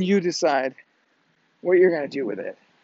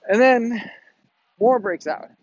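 usual speaking voice

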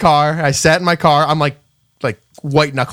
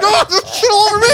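very high, crying-laughing wail; mock crying wail; 3 dB louder than usual. Pumper answering the owner, crying-laughing wail. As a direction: wail it like you're about to cry. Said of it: "I'm like".

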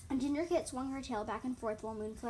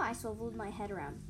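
ordinary delivery

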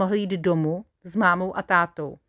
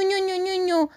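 put-on a much higher, baby-talk coo; 11 semitones higher than usual. A narrator's reading. Soldier coos at the wild sheep, high baby talk. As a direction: coo over a cute sheep. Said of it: "dělá na něj taky ňu".

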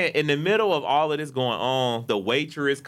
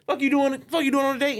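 much higher, incredulous mock outburst; angry; 13 semitones higher than usual. Boyfriend hearing his girl is on a date, angry. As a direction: imitate a jealous man's demand. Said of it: "his anger was not at me".

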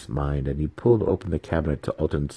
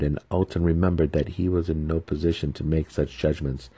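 ordinary delivery, nothing marked

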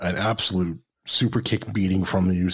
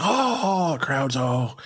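imitating the crowd; 5 semitones higher, much slower than usual. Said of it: "And they're like".

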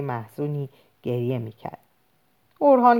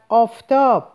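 a loud, higher cry of alarm; shout; 6 semitones higher, 6 dB louder than usual. A narrator's reading. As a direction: call out the sun to the whole street. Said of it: "کسی فریاد زد".

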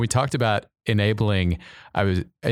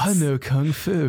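higher put-on movie hero voice; Keanu Reeves; 7 semitones higher than usual. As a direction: deliver it like the action-movie hero. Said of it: "It's kind of my Keanu voice".

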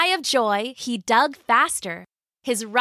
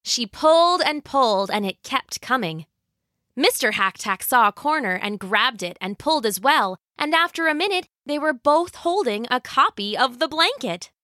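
usual speaking voice

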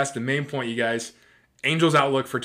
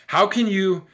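raised, indignant outburst; ranting; 6 semitones higher, 5 dB louder, slower than usual. Real, not acted. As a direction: burst out higher and louder in exasperation. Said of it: "I'm ranting".

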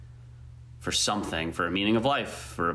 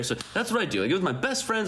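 excited; 10 semitones higher, faster than usual. Real, not acted. Excited about live music.

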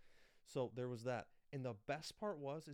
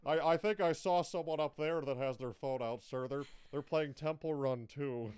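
loud, laughing tattletale steward; flight attendant; 3 semitones higher, 11 dB louder than usual. Retold or imitated. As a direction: call it out like a snitching steward.

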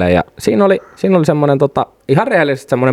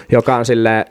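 usual speaking voice

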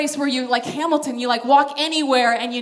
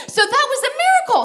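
high, fast, bursting with laughter; laughing; 11 semitones higher, 6 dB louder, much faster than usual. Real, not acted. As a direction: laugh it out, much higher.

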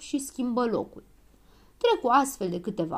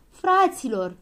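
loud, raised cry to the group; higher, louder storybook shout; shouted call; 5 semitones higher, 6 dB louder, slower than usual. A narrator's reading. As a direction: call out urgently to companions. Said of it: "strigă doctorul Pilulă".

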